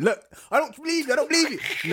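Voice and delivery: put-on high, loud upset girlfriend; mock lovers'-quarrel outburst; fighting back. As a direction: protest high and loud, hammed-up. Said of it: "And she's fighting".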